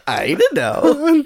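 slow giggling words; giggling through it; giggly. Real, not acted. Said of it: "[name], why are you giggling?".

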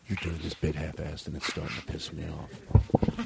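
low, quiet grumble of annoyance; hushed irritation. Pretend. Host scolding the bit, hushed.

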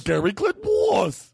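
"Glengarry Glen Ross" very high and slow; high, dragged-out "Glengarry Glen Ross"; insistent garbled title. Acted. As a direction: pitch "Glengarry Glen Ross" way up, slurring "Ross" to "woss". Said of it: "what's what I say".

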